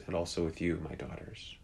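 soft, fading reply that drops to a whisper; quiet farewell. Retold or imitated. Father answers his daughters while being dragged out, softly. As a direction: let the last words fall away to breath.

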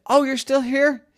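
high, surprised zebra. A narrator's reading. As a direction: sound surprised the guests stayed. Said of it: "Zebra woke up and said".

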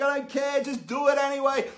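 egging on a giggling daughter; insistent urging. Retold or imitated. Dad urges his laughing daughter to keep going, loud and insistent. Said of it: "And I'm like".

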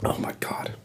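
breathless whisper after coughing. Real, not acted. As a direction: whisper it, spent. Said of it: "I gotta take another sip of water".

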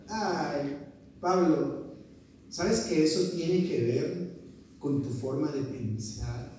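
low, soft imitation of a patient teacher; gentle reproof. Teacher to stressed student about his thinking, gentle reproof.

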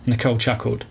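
ordinary delivery, nothing marked

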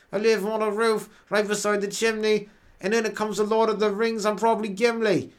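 high, chanted mock English rap through the nose; hammed-up English MC, all nose; nasal English rapper. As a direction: rap like a nasal British caricature. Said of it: "maybe there's an English rapper".

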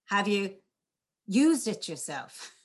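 "used" stressed and drawn out slowly; teacher demo, emphasis on "used". Shown as a demonstration. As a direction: lean on "used", take it slow. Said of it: "you could emphasize different words".

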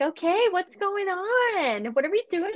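slow, high try-hard "what's going on"; trendy middle-aged parent. Retold or imitated. Mocking TikTok parents trying to be cool, slow high try-hard. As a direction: play a mom trying to sound young. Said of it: "forty-year-old is like".

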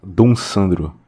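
ordinary talk

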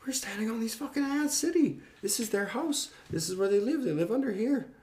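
high-pitched, strained alarm; acting out frantic distress; panicked pleading. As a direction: plead urgently on the ants' behalf. Retold panic over the ants' home.

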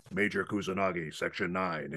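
in character as Bateau, a shifted voice. Retold or imitated. Proving to the fan in Costco, in Bateau's voice. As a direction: prove it by becoming Bateau. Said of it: "I'm the voice of Bateau".

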